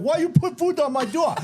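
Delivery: high-pitched mock yell from the back; mild mock yell, pitched high; mimicked screaming wife. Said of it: "his wife's in the back fucking screaming".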